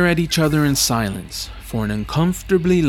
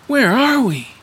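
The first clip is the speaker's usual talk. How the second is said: high-pitched, louder amazement; astonished question; 12 semitones higher, 5 dB louder than usual. Acted. Traveler staring across a vast metropolis, amazed. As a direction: blurt it out in wonder. Awed arrival in a vast city.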